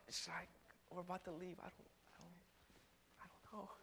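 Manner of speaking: very soft, unsure self-talk; barely voiced second thoughts; hesitant muttering. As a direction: trail off into a hushed, doubtful mumble. Hesitating over riding again.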